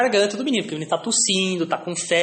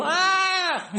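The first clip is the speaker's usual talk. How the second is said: "ah" agudo imitando o menino; criança fazendo "ah"; 13 semitones higher than usual. Retold or imitated. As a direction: solta o "ah" da criança com a boca aberta. Menino abre a boca no exame. (menino no exame de garganta, "ah" agudo)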